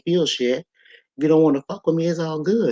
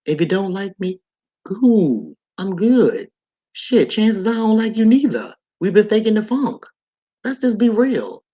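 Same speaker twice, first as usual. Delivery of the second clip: higher and louder, brushing them off; raised, dismissive don't-care tone; defiant; 5 semitones higher, 3 dB louder than usual. Real, not acted. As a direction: shrug off the haters, cocky and loud.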